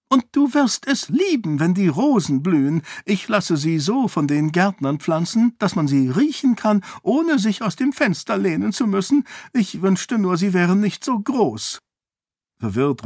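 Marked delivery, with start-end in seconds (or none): 0.1-11.8 much higher, breathless prattle; high chattering queen. A narrator's reading. Queen prattles to Sarene about roses in a high voice. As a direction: babble on happily about the roses. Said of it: "sagte Eshen mit hoher Stimme".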